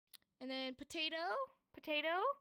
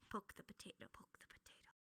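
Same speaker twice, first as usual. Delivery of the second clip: whispered; 4 semitones lower, 15 dB quieter than usual. Shown as a demonstration. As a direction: whisper it, unvoiced and hushed. Teacher acting poking potato softly.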